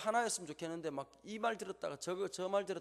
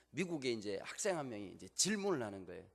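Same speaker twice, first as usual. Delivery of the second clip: normal talking voice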